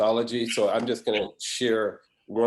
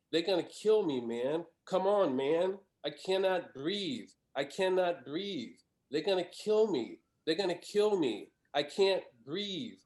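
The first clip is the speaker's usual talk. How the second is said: higher, desperate pleading voice; voicing a dying man's pleas in a higher voice; pleading last words; 6 semitones higher than usual. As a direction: beg for breath, over and over. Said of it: "George Floyd's last words".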